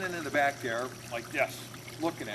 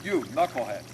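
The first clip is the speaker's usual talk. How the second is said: gruff father ordering; 3 semitones higher than usual. Retold or imitated. Father breaking up his daughter's argument with her boyfriend, ordering sternly.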